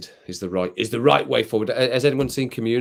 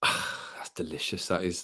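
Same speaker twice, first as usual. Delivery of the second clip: soft, slow, breathy whisper after a sip; hushed and slow, savouring the taste; whispered delight; 4 semitones lower, 8 dB quieter, slower than usual. Real, not acted. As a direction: sigh out the pleasure of the drink.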